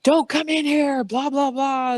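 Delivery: high, drawn-out mock holler; retold brother's yell. Brother keeping sister out, retold frightened yell. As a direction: imitate a scared kid yelling through a door. Brother slams door on her, yelling.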